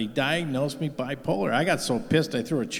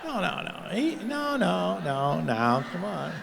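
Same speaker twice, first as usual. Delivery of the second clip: laughing denial; 7 semitones higher, slower than usual. Real, not acted. Backpedalling after Biden joke.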